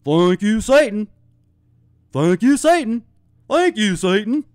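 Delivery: reversed audio, high and loud; eerie backwards-playback voice; played backwards. Shown as a demonstration. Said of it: "Now let's play that backwards".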